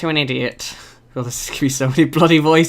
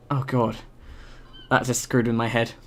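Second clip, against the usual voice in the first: ordinary talk, nothing marked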